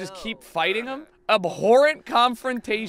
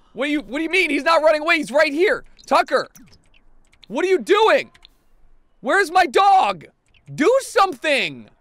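high, loud, desperate demands; frantic shouting; 8 semitones higher, 7 dB louder than usual. Real, not acted. Player losing the dog in game, frantic shouting. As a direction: demand action in a raised, frantic voice.

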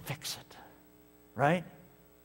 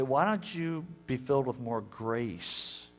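lower, softer, slow gentle voice; softer lower gentle divine voice; voice of God; 6 semitones lower, 6 dB quieter than usual. Acted. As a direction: speak as a patient God. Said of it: "He's gonna say".

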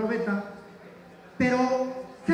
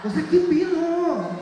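exasperated; 7 semitones higher, 5 dB louder, slower than usual. Acted. Exasperated rhetorical question.

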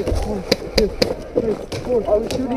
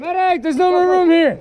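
high urgent yell; man down shout; 6 semitones higher, 5 dB louder than usual. Real, not acted. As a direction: shout over the chaos. Crowded casualty point, calling out.

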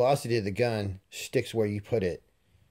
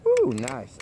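delighted ooh; 5 dB louder than usual. Real, not acted. Pleased by a tight shot group.

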